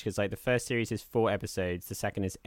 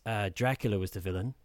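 usual speaking voice

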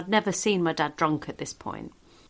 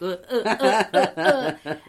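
laughing grunts; 8 dB louder than usual. Retold or imitated. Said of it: "I wish people could see".

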